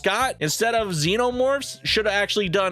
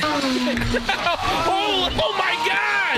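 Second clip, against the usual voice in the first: excited shout; 10 semitones higher, much slower than usual. Real, not acted. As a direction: yell in sheer disbelief. Amazed reaction to bursting plant.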